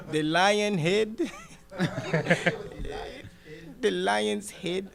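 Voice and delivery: mock his parents' Nigerian accent.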